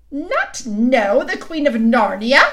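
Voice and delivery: loud, slow, haughty outrage; the Queen cries out at him; indignant cry. A narrator's reading. Queen cries out at Edmund, loud and indignant. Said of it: "cried she".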